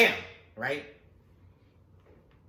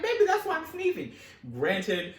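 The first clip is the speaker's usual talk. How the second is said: higher, louder fretting mom; mom's fretting; 12 semitones higher, 7 dB louder than usual. Retold or imitated. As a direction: fuss and worry, sniffly. Mom voiced sneezing.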